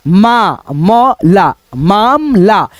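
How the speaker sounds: low, careful syllable split of मामला; spelling out syllables. Shown as a demonstration. Teacher breaking मामला into syllables, low and careful. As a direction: say मा, म, ला one by one, then मामला. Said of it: "हमने अभी लिखा दोस्तों मामला".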